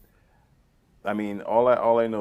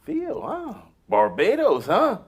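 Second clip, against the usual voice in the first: high, swooping sitcom dad impression; Doctor Huxtable; 13 semitones higher, 4 dB louder, slower than usual. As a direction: imitate an impressed TV father, loud and high. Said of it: "Doctor Huxtable was like".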